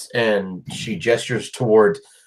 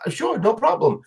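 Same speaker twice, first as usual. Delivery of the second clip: high lady voice; 9 semitones higher than usual. Acted. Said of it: "she goes".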